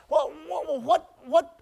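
usual speaking voice

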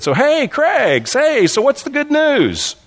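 imitating a high, drawn-out friendly hello; casual greeting. Friend casually greeting the preacher, imitated in a high breezy voice. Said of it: "walked up to me very casually and said".

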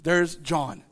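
ordinary talk

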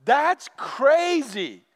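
loud startled whoa, whoa, then a slow stunned that's crazy; shocked listeners. Retold or imitated. People hearing Jesus, loud shocked whoa. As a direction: stop them short, amazed. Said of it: "was like".

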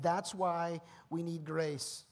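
drawn out slowly, each word weighed; slow emphatic. Real, not acted. Preacher to congregation, stating the need for grace slowly. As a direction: let the point land slowly. Slow first statement of point.

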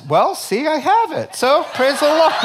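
high, loud gloating glee; gleeful friend. Acted. Greedy buddy gloats to his friend, high and loud. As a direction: crow over the bigger steak.

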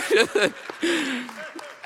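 high laugh at own joke. Real, not acted. Preacher had to get one joke in, laughing high. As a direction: let out a high, amused laugh.

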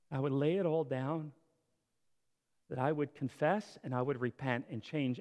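closing prayer. Acted. Pastor leading the congregation in prayer, hushed and earnest.

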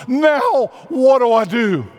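high, pleading cry of what now; torn and anxious. Acted. Jacob, cheated by Laban, cries out in high distress. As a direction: voice a trapped man's distress, pitched high. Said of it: "all emotionally torn".

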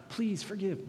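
high plea; mock begging. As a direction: beg again, lifted. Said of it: "he was begging him".

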